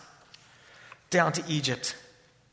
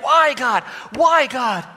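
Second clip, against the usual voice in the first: louder, higher cry to God; anguished cry; 4 semitones higher, 5 dB louder, slower than usual. Retold or imitated. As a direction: cry out while pounding the bureau. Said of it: "and he was like".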